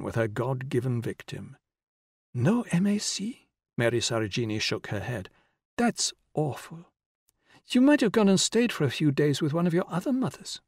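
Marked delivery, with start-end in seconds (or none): 2.3-3.5 put-on slow, high-pitched child's voice; little girl asking in disbelief. A narrator's reading. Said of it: "said the child". 5.8-10.6 put-on higher-pitched child's voice. A narrator's reading. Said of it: "said the child".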